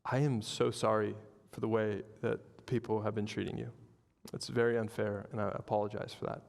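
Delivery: low, soft, gentle apology; sincere apology. Retold or imitated. Passenger apologising to mistreated flight attendant, gently sincere.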